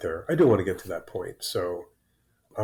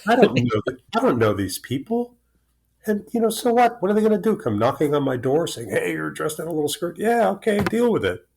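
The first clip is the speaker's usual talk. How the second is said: defiant retort; 8 semitones higher, 3 dB louder than usual. Retold or imitated. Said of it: "And I'm going, like".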